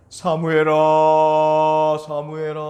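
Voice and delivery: slow, loud calling of the name; calling voice of God. A narrator's reading. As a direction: call out the name from afar, drawn out. 하나님이 부름. (멀리서 부르는 하나님의 목소리)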